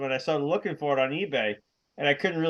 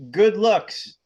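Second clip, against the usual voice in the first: good looks said high and loud; 1 announced phrase; 5 semitones higher, 6 dB louder than usual. Shown as a demonstration. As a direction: present "good looks" with a lifted GOOD. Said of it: "today's What Dat Mean is".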